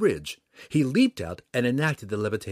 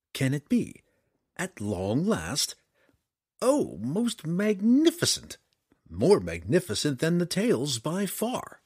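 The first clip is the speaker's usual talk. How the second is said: higher, swelling wonder; feigned awe; 6 semitones higher than usual. Acted. Drizzt flatters the dragon to deceive it, in feigned awe. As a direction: marvel grandly, stretching the praise.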